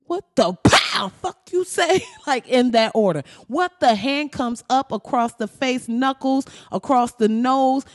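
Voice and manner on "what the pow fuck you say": louder, rising mama yell with a slap sound. Retold or imitated. Mama slapping her rude son, loud scolding yell. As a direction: slap and scold in one breath.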